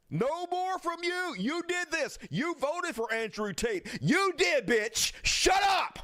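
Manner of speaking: high, heated tirade at a voter; furious rising yell at a hypocrite; angry rant. Real, not acted. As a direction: tear into her, voice climbing. Ranting at quoted voter.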